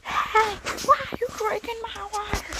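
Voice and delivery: high, fast, indignant squeak; high squeaky voice. Pretend. Voiced figure scolds thief.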